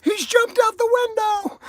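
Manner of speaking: very high, loud panicked cry; panicked mom. Retold or imitated. Mom and sister finding the window open, very high panicked cry. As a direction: shriek it in alarm. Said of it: "They went".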